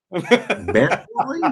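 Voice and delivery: hillbilly American Bentley, breaking into a laugh; laughing hillbilly accent. Pretend. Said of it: "I love that".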